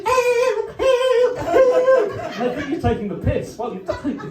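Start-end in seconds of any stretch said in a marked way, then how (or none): 0.0-2.1 very high, loud squeaky helium cries for help. Retold or imitated. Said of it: "suffocating and going".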